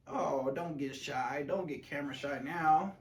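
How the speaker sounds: coaxing pet voice. Real, not acted. Coaxing the dog on camera.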